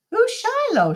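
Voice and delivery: high, surprised mother's question; Ma asking. A narrator's reading. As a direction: voice Ma's sudden puzzled question. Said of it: "she asks".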